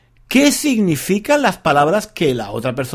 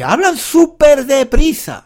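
exasperated emphasis; 9 semitones higher, 4 dB louder than usual. Real, not acted. Complaining how fast actors speak.

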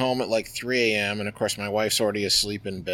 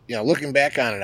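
ordinary talk, nothing marked

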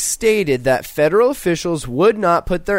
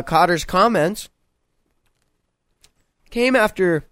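talking around a piece of candy; chewing while talking. Real, not acted. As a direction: mouth full of candy. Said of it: "excuse me, I'm trying to eat this candy".

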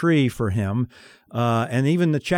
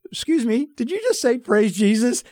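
high surprised question; 8 semitones higher than usual. Retold or imitated. Turning back to ask the woman, high and surprised.

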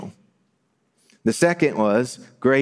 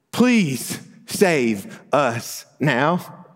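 very slow, higher-pitched acted plea; drawn-out cry; 4 semitones higher, much slower than usual. Crowd calling to the king riding by, slow pleading cry. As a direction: cry out each word, stretched long. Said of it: "but you might shout".

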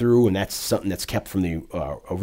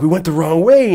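panicked shout; 8 semitones higher, 8 dB louder than usual. Retold or imitated. Scheider panicking in storm.

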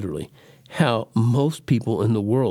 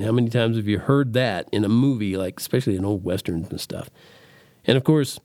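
normal talking voice

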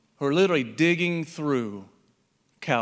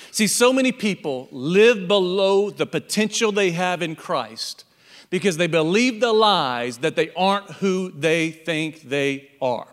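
usual speaking voice

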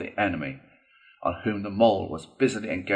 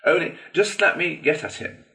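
high-pitched, pent-up indignation; itching to get at an enemy; worked-up little Toad voice; seething Toad; 8 semitones higher than usual. A narrator's reading. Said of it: "muttered Toad, grinding his teeth".

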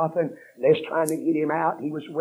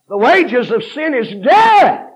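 slow, loud, much higher proclamation; thundering preaching; 9 semitones higher, 14 dB louder, slower than usual. Acted. Preacher warning the congregation, thundering slow and loud. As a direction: boom it out to the congregation.

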